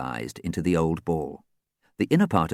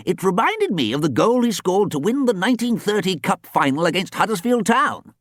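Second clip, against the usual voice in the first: put-on high, proud old coach voice; old coach ghost praising; 11 semitones higher, 3 dB louder than usual. Ghost coach praising a player, high proud old voice.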